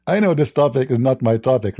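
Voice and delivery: ordinary delivery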